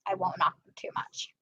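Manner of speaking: whispered aside; a soft whispered afterthought. Real, not acted. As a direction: drop to a conspiratorial whisper. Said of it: "aside after disliking socks".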